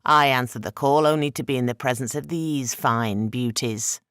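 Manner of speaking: a proud spirit showing off his home; trickster god Eshu. Acted. Trickster god's voice.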